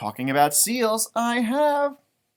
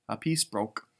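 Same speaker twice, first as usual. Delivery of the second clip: ordinary delivery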